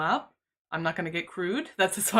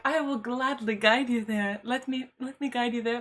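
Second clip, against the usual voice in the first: bow verbally, ever so formal; very formal politeness; 4 semitones higher than usual. Retold or imitated. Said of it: "in the most formal of Japanese".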